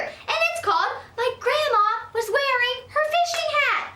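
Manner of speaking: high, loud slow delight; thrilled. Acted. Girl spotting grandma's fishing hat, high and thrilled. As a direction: savor the happy surprise. Child excited discovery.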